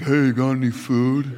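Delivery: slow, put-on dog voice begging for food; lightly voiced begging dog; voicing the dog. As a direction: give the dog a voice through the screen door. Henry would be looking through our screen doors like.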